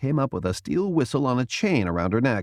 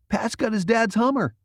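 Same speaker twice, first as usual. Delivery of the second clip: eager Jason; 12 semitones higher than usual. A narrator's reading. Jason argues.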